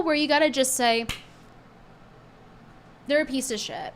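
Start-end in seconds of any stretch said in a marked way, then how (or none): none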